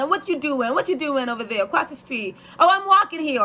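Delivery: high mock New York street call; hammed-up New Yorker calling across the street; New Yorker imitation. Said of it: "they talk really fast".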